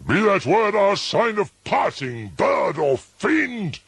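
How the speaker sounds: high, loud furious shriek. A narrator's reading. Narrator shrieks at the raven to leave. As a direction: scream it at the bird. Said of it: "I shrieked".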